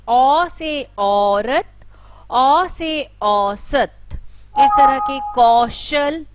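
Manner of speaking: औरत said very slowly, औ drawn out loud; long au vowel demo. Shown as a demonstration. Teacher to pupils, lengthening the औ. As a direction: stretch the औ in औरत. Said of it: "इसका उच्चारण करेंगे तो किस तरह से करेंगे".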